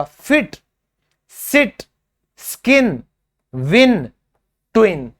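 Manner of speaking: isolated words, short vowel; short i demo. Shown as a demonstration. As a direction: model the short इ crisply. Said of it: "जो sound है ना वो बोला जाएगा".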